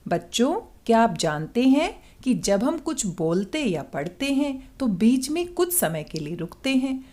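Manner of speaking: leisurely reading with clear breaks between phrases; unhurried, with pauses. Shown as a demonstration. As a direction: read at an easy, measured pace. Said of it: "आइए इन्हीं पंक्तियों को अब आराम-आराम से पढ़ते हैं".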